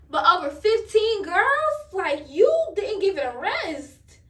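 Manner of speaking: outraged disbelief. Retold or imitated. To the cheating boyfriend, disgusted, loud and high. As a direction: drag it out, appalled he never stopped.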